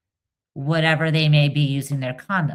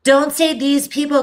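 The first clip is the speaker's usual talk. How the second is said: usual speaking voice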